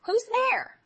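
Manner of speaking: high, loud quavering call; old grandmother calling. Acted. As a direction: call out from the bed. Grandmother calls out.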